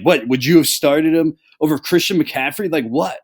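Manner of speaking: a hammed-up what-are-you-talking-about; baffled outburst. Retelling Greg's reply to a simple question, incredulous and loud.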